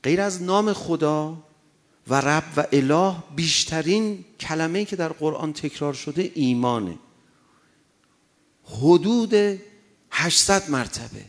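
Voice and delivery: loud sermon pushed hard; full-force preaching. Acted. Preacher urging the congregation toward faith, loud and insistent. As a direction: drive the point home from the pulpit.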